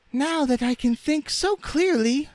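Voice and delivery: very high, squeaky rag doll; Raggedy Ann's voice. A narrator's reading. Rag doll confessing muddled thoughts, squeaky. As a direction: speak in a tiny piping falsetto.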